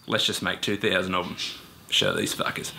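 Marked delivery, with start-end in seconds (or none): 1.9-2.7 mock Nintendo exec in a deep Australian accent. Nintendo bosses scheming in his fantasy, deep Australian accent. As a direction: growl it like a gruff Australian boss. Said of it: "they have a deep Australian accent".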